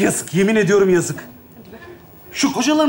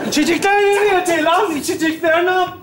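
high, raised calling voice; 7 semitones higher than usual. Acted. Friend asking the host about the drinks, calling out. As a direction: call through to the next room. Friend calls from another room.

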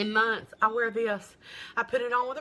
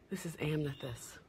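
hushed whisper, lower, softer; 7 semitones lower, 11 dB quieter than usual. Real, not acted. To her live viewers, unveiling the amethyst shade: dramatic whisper. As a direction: let the name slip out in a secret hush. Reveals a bold eyeshadow shade.

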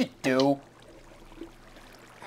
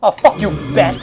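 angry outburst; 3 semitones higher, 5 dB louder than usual. Real, not acted. Angry at bat in game.

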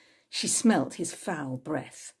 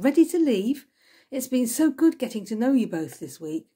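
higher, louder glad farewell; cried out brightly; 6 semitones higher, 4 dB louder than usual. A narrator's reading. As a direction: call out warmly to the departing guests. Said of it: "she cried".

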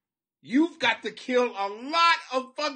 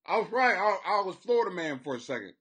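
usual speaking voice

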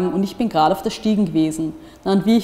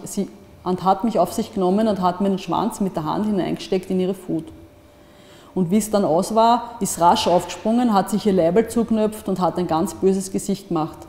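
plain reading voice

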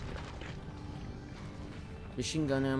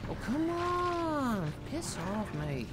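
exasperated; 10 semitones higher, slower than usual. Real, not acted. Exasperated at enemy.

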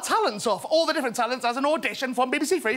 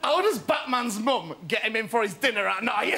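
ordinary talk, nothing marked

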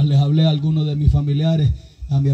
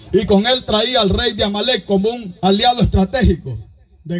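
raised, driving pulpit voice, pushing each phrase; fiery preaching; 5 semitones higher than usual. Acted. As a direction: preach it to the whole congregation. Preacher retelling Saul story.

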